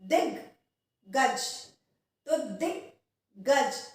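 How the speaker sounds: दिग्गज slowly with a hold on दिग before गज; slow careful pronunciation. Shown as a demonstration. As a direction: say दिग्गज slowly, pause on the doubled ग. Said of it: "अब इसमें दिग रुक रहे हैं हम". (धीरे से दिग्गज, दिग पर रुककर)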